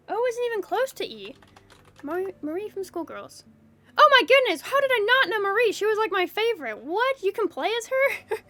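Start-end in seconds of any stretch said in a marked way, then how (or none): 4.0-8.4 excited. Real, not acted. Sudden recognition of favorite character.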